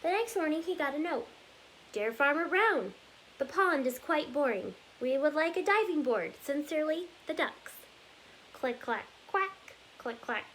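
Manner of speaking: lively storybook read-aloud, rhythmic and bouncy; reading to children. Acted. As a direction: read it like story time for little kids. Reads picture book aloud.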